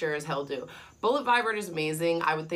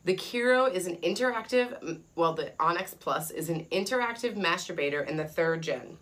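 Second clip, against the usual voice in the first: ordinary talk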